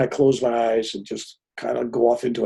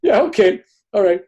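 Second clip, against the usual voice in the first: dismissive wife; 11 semitones higher, 3 dB louder than usual. Retold or imitated. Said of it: "She goes".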